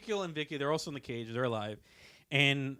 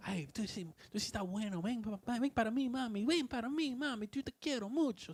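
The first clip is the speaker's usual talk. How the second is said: much higher, crooning Spanish pickup line; Spanish donkey flirting; 8 semitones higher, 5 dB quieter than usual. Retold or imitated. Joke donkey sweet-talking another donkey, high and crooning. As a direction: woo the donkey in a high sing-song voice. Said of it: "He's like".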